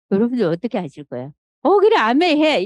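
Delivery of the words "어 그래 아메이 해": high, loud cheerful yes; mock eager approval. Mother to audience, mock eager consent, high and loud. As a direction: cheer the choice on, hammed up.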